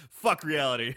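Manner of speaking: laughed-out quip, drawn out; laughing. Real, not acted. Co-host capping a movie joke, laughing. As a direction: crack up while saying it.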